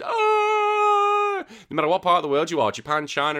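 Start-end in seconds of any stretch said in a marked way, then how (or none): 0.0-1.5 very high, loud drawn-out scream; excited scream. Pretend. Fan screaming over old Pokemon games coming back, high joyful shriek. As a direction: let out a fan's squeal of joy. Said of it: "you'll freaking hear me screaming".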